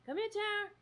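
very high, soft coaxing call; cat-calling voice. Real, not acted. Owner coaxing her cat to come, very high and soft. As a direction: coo at the cat in a tiny high voice. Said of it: "Does that sound weird going".

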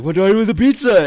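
quick high mock fluster; a hint of a lost guy holding pizza; lightly voiced confused friend. Nathan's friend mimicking him asking about the pizza, mock flustered. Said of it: "and so Nathan's like".

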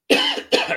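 sudden harsh coughing fit; real cough. Real, not acted. Host on a podcast coughing mid-sentence. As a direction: cough hard, then catch yourself. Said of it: "sorry, I'm all choked up here".

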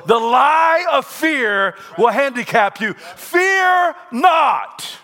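loud, high shouting sermon; preaching at full force. Acted. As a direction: hammer home the warning against fear.

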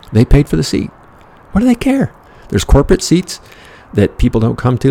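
rising frustration, almost yelling; exasperated rant. Retold or imitated. Ranting retort.

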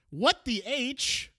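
"What the H" slow and high; mock-dramatic question, "H" stretched out high; drawn-out catchphrase. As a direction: hold each word of "What the H", hammed up. Repeats catchphrase for the drop.

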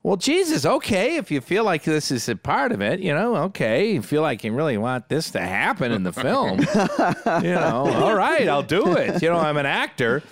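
high, resigned, half-laughing giving in; a mock-weary actor caving; reluctant agreement. Ned to the director, caving in a high resigned voice. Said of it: "He's like".

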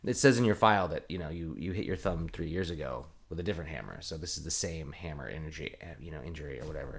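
low, quiet mock official excuse; dismissive bureaucrat. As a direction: lightly imitate an official fobbing someone off. Acts out hospital refusing to pay.

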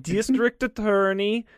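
hammed-up German accent. Dying judge sending the attorney after the bomber, German accent. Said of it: "do a German accent".

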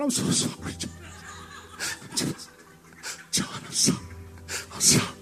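voiceless, soft, laughing whisper; mock hushed apology. Pastor apologises to his winded employee, mock whispered sorry. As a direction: whisper it, falling away into nothing.

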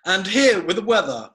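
bright TV announcer lead-in; weather presenter intro. Shown as a demonstration. Host trying out names, TV weather intro voice. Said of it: "would your preferred name be".

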